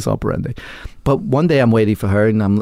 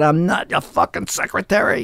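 yelling; 6 semitones higher than usual. Retold or imitated. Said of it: "I'm yelling".

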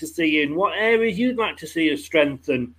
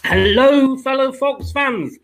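excited; 7 semitones higher, 4 dB louder, slower than usual. Real, not acted. Bouncy show opener to fans.

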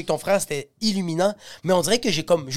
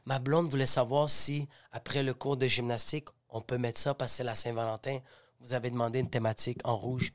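à voix très basse; 6 semitones lower, 10 dB quieter than usual. Retold or imitated. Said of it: "je fais".

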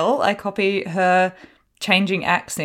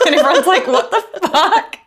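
high, laughing disbelief; laughing mock bafflement; 9 semitones higher, 4 dB louder than usual. Host quoting onlookers' reaction to Bella, laughing bafflement.

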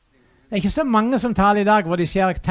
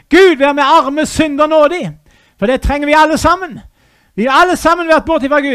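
a touch louder, proclaiming grace; fervent preaching; 3 semitones higher, 4 dB louder than usual. Acted. Preacher proclaiming grace to all, fervent. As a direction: proclaim it to the hall.

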